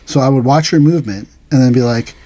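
ordinary delivery

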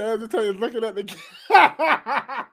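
laughing shout. Real, not acted. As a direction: laugh so hard the words collapse. Laughing outburst at a reaction.

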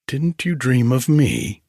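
plaintive, gently reproachful; wistful. A narrator's reading. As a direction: ask with a little catch of reproach. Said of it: "asked Daphne wistfully".

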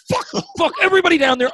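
high, fast frantic outburst; acted-out frantic yelling; panicked cursing. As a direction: curse them all in a high-pitched panic. Retold panic cursing those below.

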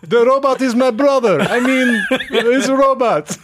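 high, laughing plea for a brother; pleading high voice. Pretend. Voicing the robot's brother.

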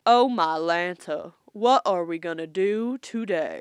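slow, exaggerated Deep South voice; Southern accent. Imitating a Mississippi boy's Southern accent for co-host. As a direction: ham up a genteel Southern boy. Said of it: "he's a very low voice".

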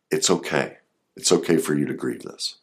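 soft, gentle reassurance; reassuring. Shown as a demonstration. Therapist comforting the inner child, soft and reassuring. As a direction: quietly comfort the hurt child within.

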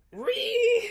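shrill laughing squeal, way up high. Real, not acted. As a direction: let out a piercing squeal of laughter.

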